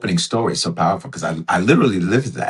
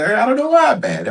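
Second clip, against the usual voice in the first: high, louder mock exasperation; exasperated bewilderment; 5 dB louder than usual. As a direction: act out baffled irritation, pitched way up. Voicing someone mad without knowing why.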